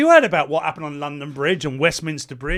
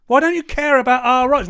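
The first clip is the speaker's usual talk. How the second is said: raised, loud indignant challenge; indignant outburst; 6 semitones higher, 6 dB louder than usual. Real, not acted. Ranting at the Greens, loud and indignant. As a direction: demand an answer, loudly.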